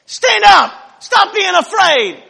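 rally a frightened crowd to stand up; rousing shout. Retold or imitated. Moses shouting to Israel to stand up. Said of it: "And Moses says".

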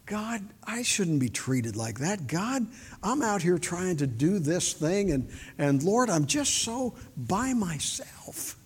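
soft, wounded whine to God, slowing at the end; self-pitying complaint. Retold or imitated. Worn-out door knocker complaining to God, self-pitying. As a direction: act out feeling sorry for yourself in prayer. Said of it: "But I love self-pity".

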